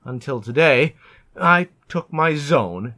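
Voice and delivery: ordinary delivery, nothing marked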